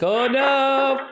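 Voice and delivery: high-pitched mock dismay; mimicking the man. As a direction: imitate his dismayed cry, playfully. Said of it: "he's like".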